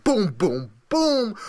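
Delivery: booms rising to a loud high shout; hype booms. Acted. Host hyping his bracket, booming sound effects.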